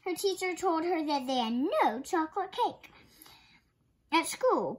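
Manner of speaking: plain reading voice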